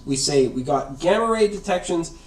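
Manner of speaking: ordinary delivery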